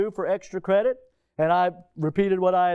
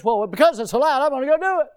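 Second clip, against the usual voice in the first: mock defiant excuse; 7 semitones higher, 6 dB louder, faster than usual. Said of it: "Everybody thinks".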